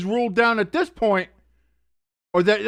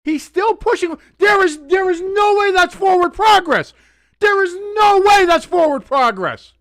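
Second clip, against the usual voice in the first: loud, higher outraged protest; indignant yelling; 8 semitones higher, 4 dB louder than usual. Real, not acted. Fan protesting the forward progress ruling, indignant yell. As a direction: argue the call at the screen.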